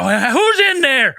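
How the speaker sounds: high, drawn-out call through a door; hammed-up demand of an unseen intruder; mock challenge. Retelling armed men opening the door, mock challenge.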